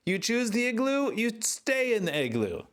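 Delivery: high-pitched mock scolding; exasperated high scold. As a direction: squeal it like a fed-up owner. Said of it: "And then I was like".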